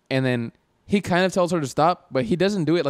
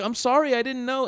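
high-pitched, pleading mock apology; imitated apologizing; 10 semitones higher than usual. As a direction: act out a driver's sincere apology in a raised pitch. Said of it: "like, apologizing".

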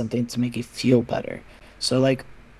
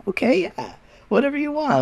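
indulgent friend; 13 semitones higher than usual. Retold or imitated. Friends humoring her tantrum.

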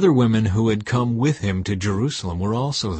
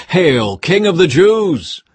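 loud, slow, higher sneering hail; mocking salute; 7 semitones higher, 8 dB louder, slower than usual. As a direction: stretch it out as a jeering soldier.

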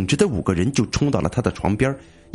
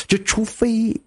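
ghostly; 10 semitones higher, slower than usual. Acted. Ghost ominous line.

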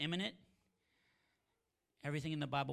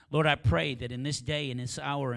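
loud; 11 dB louder than usual. Acted. Prayer peaks loudest.

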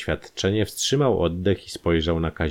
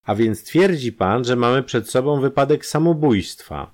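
a higher, louder, probing police voice; commissioner questioning; 7 semitones higher, 4 dB louder than usual. A narrator's reading. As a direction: press him with the question.

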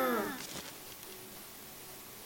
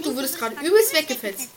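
normal talking voice

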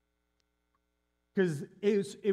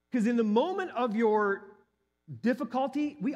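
higher and louder, swept up in amazement; amazed; 7 semitones higher, 4 dB louder than usual. Real, not acted. Pastor amazed at the church coming to comfort them, excited. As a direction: let the wonder lift your voice.

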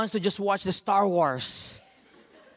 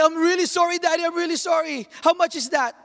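put-on high, pleading child apology; heartbroken child; 9 semitones higher than usual. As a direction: beg forgiveness like a sad kid.

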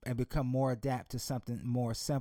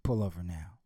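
father's voice; 7 semitones lower than usual. Acted. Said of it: "the daddy said".

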